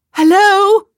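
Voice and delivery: high, loud call into the distance. A narrator's reading. Woman stuck on a roof hailing a distant figure, calling out. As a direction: holler to someone far off. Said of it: "she called out".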